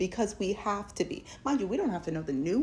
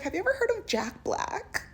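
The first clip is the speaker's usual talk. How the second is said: much higher, bubbly question; eager question; 13 semitones higher than usual. Retold or imitated. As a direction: ask it bright and way up high.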